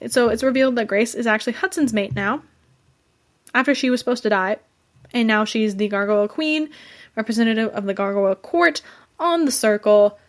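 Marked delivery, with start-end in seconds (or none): none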